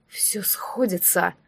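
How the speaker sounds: slow dark whisper. A narrator's reading. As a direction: breathe it out slowly. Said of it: "донёсся до меня его шёпот".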